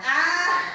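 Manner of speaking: high gleeful cackle; mock evil laugh. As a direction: cackle with glee at the prank. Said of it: "I'm in the background laughing".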